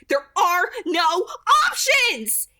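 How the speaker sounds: loud, slow, high snarl. A narrator's reading. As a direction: spit the words out in fury. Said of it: "Edith snarled".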